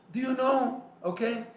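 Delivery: high, loud rhetorical question; insistent challenge. Real, not acted. Challenges the listeners directly.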